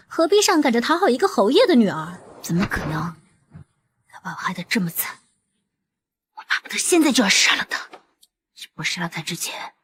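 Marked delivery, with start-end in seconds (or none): 4.1-9.7 whispering. Acted. Scheming sister whispers murder plot.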